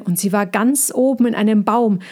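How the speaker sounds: ordinary delivery, nothing marked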